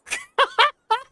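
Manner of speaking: high laughing burst; real laugh at the fight; burst of laughter. Real, not acted.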